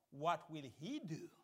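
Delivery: normal talking voice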